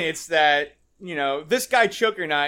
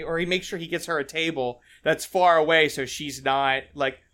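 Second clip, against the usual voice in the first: usual speaking voice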